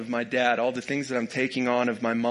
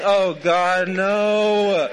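wife's embarrassed groan; 4 semitones higher, 7 dB louder, much slower than usual. Retold or imitated. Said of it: "My wife is up here going".

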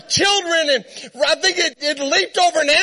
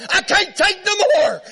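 much higher, half-sobbing wail; horse at breaking point; 5 semitones higher than usual. Retold or imitated. Horse quitting amid the candy chaos, high and sobbing. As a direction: give up in a high cracked whine. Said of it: "my horse said".

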